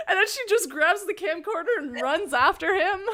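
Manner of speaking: ordinary delivery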